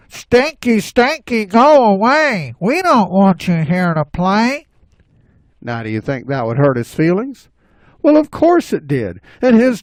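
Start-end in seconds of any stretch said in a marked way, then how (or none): 0.1-4.7 high playground taunt; drawn-out, high jeering chant. Acted. Animals sending Stinky away, high sing-song taunting. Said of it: "it would go something like this".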